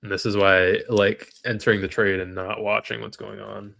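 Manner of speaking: ordinary talk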